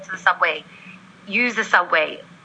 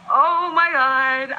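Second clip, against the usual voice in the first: slow, drawn-out overwhelmed sigh of words; 6 semitones higher, much slower than usual. Retold or imitated. Worker answering her boss while near tears, drawn out. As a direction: let it drag out of you, drained.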